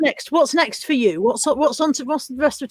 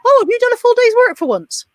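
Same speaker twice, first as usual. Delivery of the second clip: higher, louder mock-surprised teasing; imitating a cheeky delivery man; parcel shop man's jibe; 9 semitones higher, 5 dB louder than usual. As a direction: crow it loudly, teasing a slacker. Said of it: "he was basically, what the parcel shop man said".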